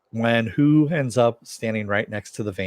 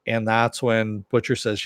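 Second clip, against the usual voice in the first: ordinary delivery, nothing marked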